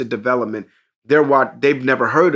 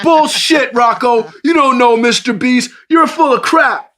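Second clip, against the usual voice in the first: high, loud jeering taunt; mocking kids jeering; 11 semitones higher, 6 dB louder than usual. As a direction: razz him like disbelieving friends. Friends mocking Rocco in chat.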